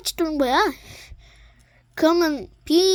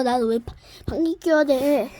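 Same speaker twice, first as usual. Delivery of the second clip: normal talking voice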